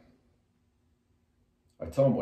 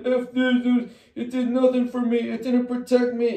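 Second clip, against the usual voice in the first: higher, louder whiny mock voice; bitter mocking imitation; 13 semitones higher, 4 dB louder than usual. Mimicking a younger self railing at God, mock whiny. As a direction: parody a bitter rant against God.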